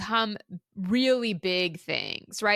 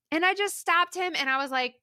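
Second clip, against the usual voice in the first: usual speaking voice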